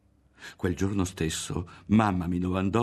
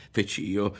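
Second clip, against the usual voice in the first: usual speaking voice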